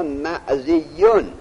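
"معزیون" very slowly, the y held double; doubled ya. Shown as a demonstration. Teacher checking students' texts for shadda, "معزیون" with doubled y. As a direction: stretch out "معزیون" and lean on its doubled y. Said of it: "تشدید داره شما یاش یا نه؟".

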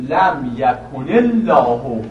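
very slow "لم یکن الله", run together as yakunil-lahu; demonstrated joined pronunciation. Shown as a demonstration. Teacher reading from the verse, slow joined "لم یکن الله". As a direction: stretch the double ل in "لم یکن الله". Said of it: "اینجور تلفظ میکنید".

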